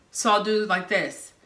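ordinary delivery

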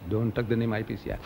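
low and soft; subdued repeat. Acted. Speaker repeating the ban to himself, low and subdued. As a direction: drop it right down, almost to yourself. Same order repeated under his breath.

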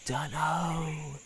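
put-on slow, offhand old spider; grumpy dismissive spider voice; rude old spider. A narrator's reading. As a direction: brush them off rudely. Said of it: "pretty normal for Wanda".

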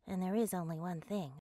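low, soft aside; muttered aside. Acted. Grown-up businessperson admitting it in passing, muttered aside. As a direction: drop low and soft for a parenthesis.

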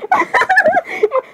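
very high-pitched laughing squeal. Acted. Speaker after saying so sorry, squealing with laughter.

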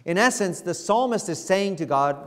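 normal talking voice

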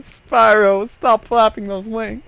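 high, mocking villain taunt; enemy voice. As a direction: taunt the dragon. Voicing the enemy to Spyro.

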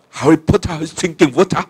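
lightly mock upper-class British English; strong British accent. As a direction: say it like a posh Englishman. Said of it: "logatnya British English yang kuat sekali". (logat British English yang kental, agak dibuat-buat)

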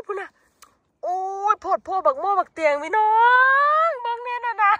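high, delighted exclaiming; excited. Real, not acted. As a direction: gush over the find. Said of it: "มันตื่นเต้นอีหลี".

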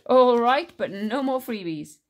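loud, high, drawn-out good-natured concession; genie. A narrator's reading. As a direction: give in loudly, then warn playfully. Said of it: "The genie laughed".

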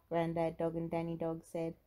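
plain reading voice

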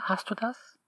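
ordinary delivery

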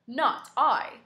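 lightly voiced cat. The cat lazily refuses the hen, lightly voiced. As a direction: brush it off like a lazy cat. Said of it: "said the cat".

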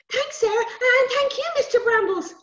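put-on high, loud little boy voice; rescued boy. Rescued boy answering the sprite, high and eager.

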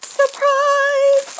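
high, loud cry of surprise; excited exclamation. Real, not acted. Pulling out a surprise toy, calling out high and loud. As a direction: call it out with glee.

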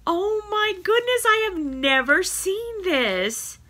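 high, drawn-out wonder; real amazement. Real, not acted. Showing viewers a surprise cat toy, amazed. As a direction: marvel at it, pitched well up.

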